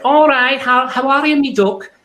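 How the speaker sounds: put-on high, sweet little old Midlands lady; high-pitched East Midlands old lady; old lady Leicester accent. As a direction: Leicester granny calling you duck. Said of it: "in a little old lady accent".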